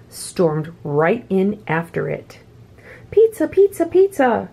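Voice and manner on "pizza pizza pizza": high alpaca shout. A narrator's reading. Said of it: "shouted the alpacas".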